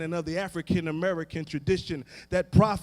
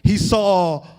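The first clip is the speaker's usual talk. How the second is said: rising, shouted and half-sung preaching, much higher and louder; full-force preaching; 6 semitones higher, 4 dB louder than usual. Acted. Preacher building to full cry.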